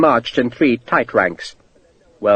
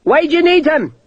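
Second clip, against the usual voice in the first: calling out; 14 semitones higher than usual. A narrator's reading. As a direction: call back from down the line. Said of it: "a voice called".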